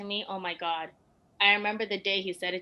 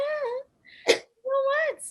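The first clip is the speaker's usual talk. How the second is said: shocked disbelief; 8 dB louder, much slower than usual. Retold or imitated. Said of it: "And I'm like".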